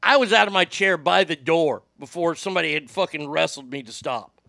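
usual speaking voice

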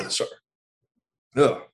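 strained voice after coughing; recovering from choking. Real, not acted. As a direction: speak while still clearing the throat. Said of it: "water went down the wrong pipe".

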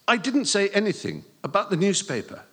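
high, loud, blurted out. Acted. Boy at teacher's door, blurting his defence. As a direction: let it tumble out in a rush. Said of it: "he blurted".

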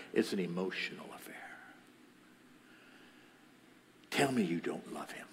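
low, soft confrontation; quiet pressing accusation. Retold or imitated. Family pressing young woman for denial, quiet accusation.

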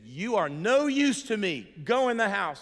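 loud, slow, cutting yell; father's scream. Retold or imitated. Father screaming at his son over the car, loud and slow. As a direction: bellow it like a furious father. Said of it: "he screamed me in my face".

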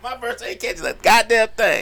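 comic outburst. Real, not acted. Host lamenting his car attracts nobody, loud comic outburst. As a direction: exclaim it in mock outrage.

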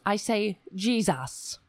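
normal talking voice

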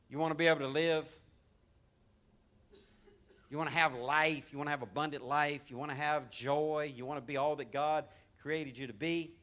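ordinary talk

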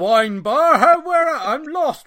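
high, loud mock posh English accent; plummy lost toff voice, hammed up; posh English impression. To mates in a Millwall pub, a hammed-up posh English accent. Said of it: "Please tell me you did that with your impressions".

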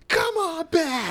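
very high, stretched-out mock plea; Dolph Ziggler impression. Co-host imitates wrestler at the ropes, hammed-up begging. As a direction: beg in a long squealed cry. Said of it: "Like, like he's".

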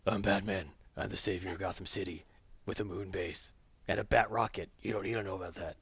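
low, gravelly-sounding deep Batman growl. Retold or imitated. Batman bragging about his secret moon base, low and gruff. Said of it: "Gary says".

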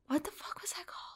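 very soft whispered question; whispering to oneself. Real, not acted. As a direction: whisper it while searching your memory. Asks herself for the forgotten name.

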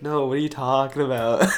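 ordinary talk

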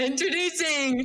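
laughing, half-hearted ring announcer; wrestling announcer. Pretend. Joking to mom about her wrestling name, mock ring announcer. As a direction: introduce the mom like a fighter, giggling. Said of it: "your wrestling name".

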